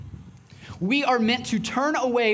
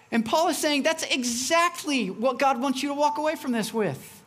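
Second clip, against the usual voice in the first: ordinary talk, nothing marked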